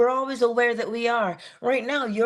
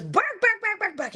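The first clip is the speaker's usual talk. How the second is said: quick, high-pitched mock barks; imitated dog barking; 12 semitones higher than usual. Teacher imitating a dog to students, high-pitched barking. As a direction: yap like a small dog. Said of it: "And he goes".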